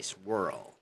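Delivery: American "swirl" with the R clearly heard; r-coloured American vowel in "swirl". Shown as a demonstration. Teacher shows what they say in American, rhotic "swirl". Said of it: "in American they say".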